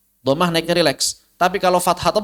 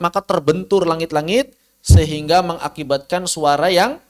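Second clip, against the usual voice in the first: ordinary talk, nothing marked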